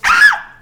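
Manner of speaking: sharp high yelp, loud and short; mimicking a hurt dog's yelp as the owner bends its back. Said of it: "the dog says".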